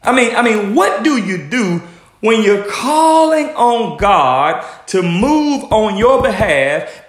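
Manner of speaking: normal talking voice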